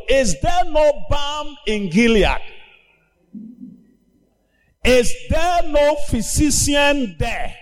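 preacher's lament; preaching cry. Acted. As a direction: call it out over the congregation. Preacher crying out God's question.

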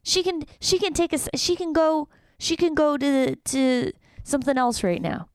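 worked-up jealous complaint; jealous outburst. Real, not acted. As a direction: protest jealously, high and loud. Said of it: "my jealousy".

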